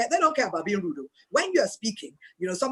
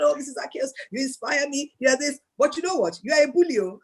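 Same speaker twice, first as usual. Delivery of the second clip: mock friend's voice; 6 semitones higher than usual. Imitating a friend's complaint.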